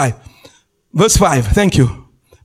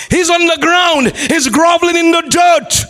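higher-pitched, insistent pulpit delivery; dramatic preaching; 6 semitones higher than usual. Real, not acted. Preacher describing Saul blind on the ground, dramatic preaching. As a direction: drive home the downfall.

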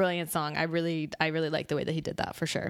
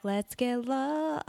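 slow dreamy sing-song; 5 semitones higher, much slower than usual. Retold or imitated. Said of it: "You don't wanna be like".

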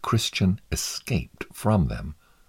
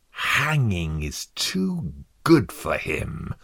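slow, drawn-out snarl; 2 semitones higher, slower than usual. A narrator's reading. Cruel juror wanting worse than hanging, snarling slowly. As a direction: relish each word with cruel menace. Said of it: "snarled Mister Cruelty".

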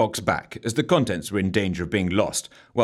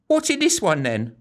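put-on timid girl voice; 8 semitones higher than usual. A narrator's reading. Bracy voiced high.

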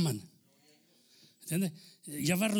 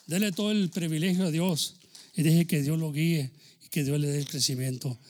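ordinary talk, nothing marked

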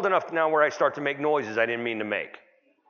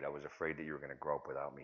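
much lower and softer, quick and sincere; tender confession; 8 semitones lower, 13 dB quieter, faster than usual. Retold or imitated. Father admitting fear to his son, tender and soft. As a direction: confess it gently to your boy.

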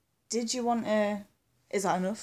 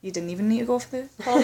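usual speaking voice

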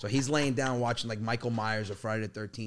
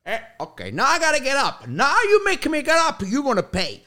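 angry dad; 13 semitones higher, 10 dB louder than usual. Retold or imitated. Son retells dad forced to get up, mock furious. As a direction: build to a high, loud threat. Acts out dad's rising anger.

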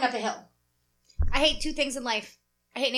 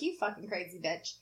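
low, much softer aside; muttered judgement; 7 semitones lower, 12 dB quieter than usual. Retold or imitated. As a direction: mutter it under your breath at her. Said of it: "giving me this look like".

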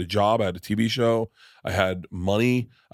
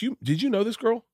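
put-on quoting girlfriend; 13 semitones higher than usual. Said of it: "she was like".

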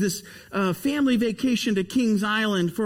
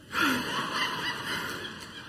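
terrified gasp; 7 dB quieter than usual. Shown as a demonstration. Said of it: "I have this".

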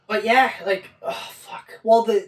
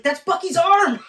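higher and louder, thrilled; excited; 8 semitones higher, 5 dB louder than usual. Retold or imitated. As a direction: blurt it out with delight. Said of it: "I was like".

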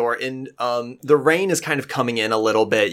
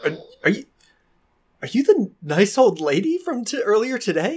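high, hesitant, bewildered voice; nervous, confused townsman, pitched up; flustered townsman; 8 semitones higher than usual. Acted. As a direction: stammer like a startled villager. Game master plays a flustered townsman.

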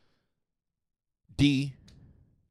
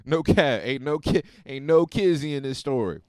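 louder, pressing that it's true; emphatic insistence; 4 semitones higher, 7 dB louder than usual. Real, not acted. Swearing to listeners the story is true, loud insistence. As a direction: insist hard, no lie.

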